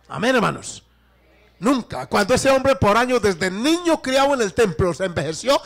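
high, loud pulpit delivery; forceful preaching. Acted.

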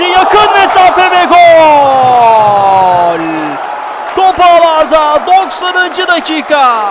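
long drawn-out loud goal scream; screaming goal call. Real, not acted. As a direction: stretch the goal shout out slowly. Late goal in ninetieth minute.

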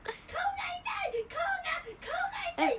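high, loud mock wail of pain; imitating a friend's cries. Teasing friend mimics her crying out in pain. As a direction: ham up the rollercoaster victim's yelp. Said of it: "ってずっと顔をさ".

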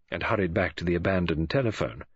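plain reading voice

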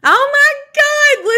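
high, loud burst of disbelief; squealed amazement; excited outburst. Real, not acted. Gushing over meeting a celebrity reader.

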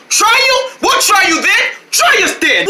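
high, worked-up challenge; heated dare. Acted. Rival boys daring each other to fight, heated.

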